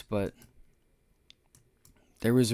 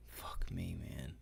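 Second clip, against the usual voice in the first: unvoiced, breathy curse under the breath; real whispered swearing; 8 semitones lower, 18 dB quieter, slower than usual. Real, not acted. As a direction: hiss it to yourself, beaten. Cursing to himself after the loss.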